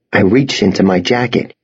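usual speaking voice

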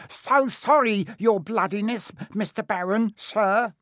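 high, oily grovel of a poltergeist; greasy grovelling. Acted. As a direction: fawn over the Baron. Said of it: "he said greasily".

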